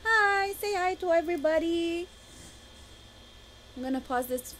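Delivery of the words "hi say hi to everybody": much higher and softer, coaxing. Real, not acted. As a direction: coo at the pet to greet everyone. Talks to her pet on camera.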